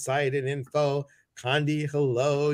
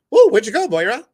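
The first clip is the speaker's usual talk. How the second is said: high, loud startled call of "where'd you go"; 10 semitones higher, 12 dB louder, faster than usual. Retold or imitated. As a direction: yelp as if she suddenly vanished. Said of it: "and I'm like".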